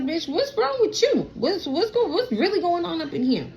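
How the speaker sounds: high, incredulous disbelief; shocked scolding at the ex-husband; exasperated. Retold or imitated. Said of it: "I'm looking at my ex-husband like".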